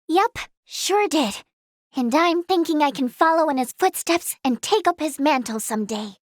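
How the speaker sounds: ordinary talk, nothing marked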